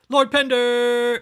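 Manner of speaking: drawn-out dramatic shout; loud slow movie shout. Retold or imitated. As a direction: call the name out slowly and loudly. Shouted movie-style name.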